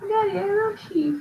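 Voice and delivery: high-pitched little cub voice; sad lion cub. Acted. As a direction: melt hearts as a tiny pleading cub. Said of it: "how sad the lion cub is".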